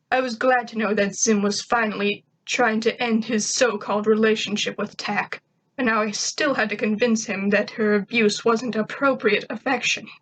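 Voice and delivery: ordinary delivery, nothing marked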